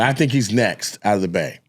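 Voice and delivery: normal talking voice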